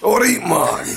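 Big Jimmy. Acted. Co-host demonstrating what the character says, Big Jimmy impression. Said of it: "he says stuff like".

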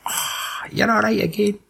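high, soft mock-sweet voice; mock sweet praise. Mocking the redemption scene, soft high mock-sweet voice. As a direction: coo it softly, way up high.

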